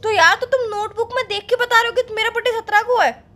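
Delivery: wife fuming that he reads her birthday from a notebook; indignant outburst. Acted. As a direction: call him out in disbelief. Angry that he checks a notebook.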